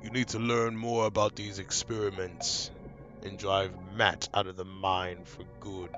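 low, hushed spirit voice; unearthly voice. Acted. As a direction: speak as an otherworldly spirit. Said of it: "I changed the blue spirit's voice".

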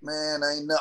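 ordinary talk

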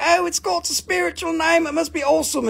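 high, gushing imitation of a buyer; mock naive enthusiasm. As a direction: play the impressed crystal fan up high. Mimicking impressed naive buyers.

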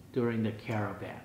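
ordinary talk

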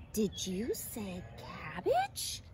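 very slow, drawn-out wondering question; incredulous little caterpillar; astonished cry. A narrator's reading. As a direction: stretch it out in amazed delight. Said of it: "cried Clara".

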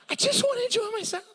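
very high, fast whining; mock whine; whiny squeak. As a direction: squeak it like a wronged child. Comic whine.

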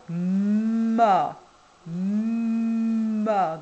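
"ma" stretched long and loud, M held before the vowel; insisted syllable sound. Shown as a demonstration. Teacher dictating a syllable to a child, "ma" insistently drawn out. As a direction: draw "ma" out with a long hummed M, swelling into the A. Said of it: "n'hésitez pas à insister".

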